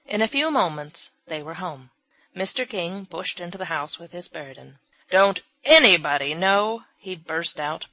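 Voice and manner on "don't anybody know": loud angry outburst; an old man bursting out in rage; furious scolding. A narrator's reading. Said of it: "scolding furiously".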